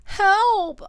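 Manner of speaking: put-on loud, high child's wail; wailed cry for help. A narrator's reading. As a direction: wail it out in alarm, acted. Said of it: "I wail".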